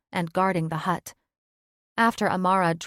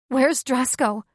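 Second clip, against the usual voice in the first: anxious question; 6 semitones higher, 6 dB louder than usual. Acted. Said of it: "she asked again".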